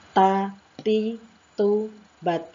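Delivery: ta, ti, tu, bat as firm, separate syllables; pronunciation drill. Shown as a demonstration. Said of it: "Kita ulang bersama-sama".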